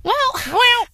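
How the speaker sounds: very high, drawn-out second thoughts; doubtful hedging. Real, not acted. Host rethinking her verdict on senior prom, doubtful. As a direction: squeak it out as you reconsider.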